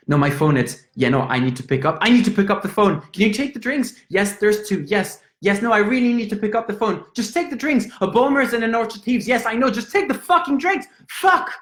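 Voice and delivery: fast, rising to a high, loud yell; hurry, panicking as the phone rings; frantic panic. Acted. A Frenchman at a Dublin bar, desperate to answer his phone, frantic and yelling.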